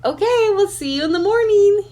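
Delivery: high, cutesy voice for the dog; speaking for the dog. Pretend. Voicing her dog's goodbye to viewers, high and cutesy. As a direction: give the dog a sweet little voice.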